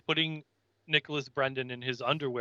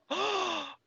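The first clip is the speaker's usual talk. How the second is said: high sharp mock gasp; shocked gasp; 4 dB quieter than usual. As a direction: imitate a startled gasp. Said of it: "she's shocked, like".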